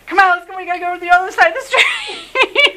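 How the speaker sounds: high, loud, laughing hurry-up; laughing urgency. Retold or imitated. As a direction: urge them away while laughing. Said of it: "And I'd".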